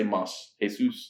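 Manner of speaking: "Mas" as mahs, "Jesus" as hay-SOOS; Spanish pronunciation. Shown as a demonstration. Host attempting to translate the beer name, Spanish "Mas, Jesus". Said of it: "how do you say even in Spanish?".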